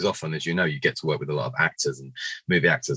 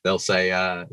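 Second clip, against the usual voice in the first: normal talking voice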